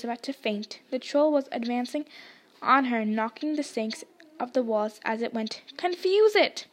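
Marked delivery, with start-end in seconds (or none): 5.8-6.7 high, desperate plea; desperate cry at the wand. A narrator's reading. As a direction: plead with the wand in panic. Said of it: "Harry said desperately".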